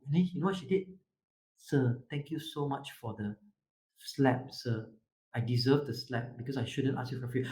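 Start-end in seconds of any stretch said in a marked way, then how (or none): 1.6-7.5 soft, low-pitched apology; humble. Retold or imitated. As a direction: lower your voice into meek, polite gratitude. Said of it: "she was humble and she was assertive".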